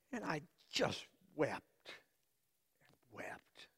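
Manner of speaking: barely a breath, as if weeping; whispered weeping. Retold or imitated. Joseph retells weeping alone.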